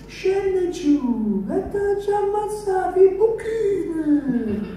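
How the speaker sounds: very high, slow enraged shriek; furious shrieking man. Retold or imitated. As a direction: screech it like a raging brute. Furious man yelling on stairs.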